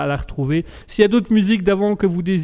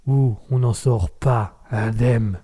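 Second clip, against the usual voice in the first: mock ominous; 5 semitones lower, much slower than usual. Dramatic tagline about Raspberry's world.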